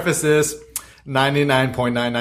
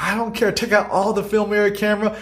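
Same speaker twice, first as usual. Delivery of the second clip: higher mock demand from the woman; imitating the angry lady; 8 semitones higher than usual. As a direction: pitch up and insist, half-hearted anger. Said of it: "She's like".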